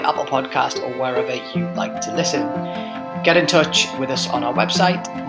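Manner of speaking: scripted sign-off over music; measured host outro read; podcast outro. Acted. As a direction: thank listeners and sign off neatly.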